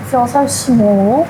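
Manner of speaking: usual speaking voice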